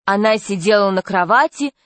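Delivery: usual speaking voice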